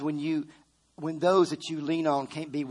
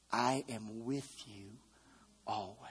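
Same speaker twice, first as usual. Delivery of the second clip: soft, slow quote; 6 semitones lower, 10 dB quieter than usual. Acted. Preacher quoting Jesus softly.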